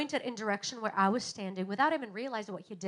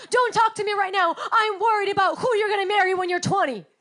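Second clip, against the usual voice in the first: loud, higher irritated brush-off; irritable snap; 7 semitones higher, 9 dB louder, faster than usual. Pretend. Worried parent snaps at her child, loud and irritated. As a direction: snap at the child to be left alone.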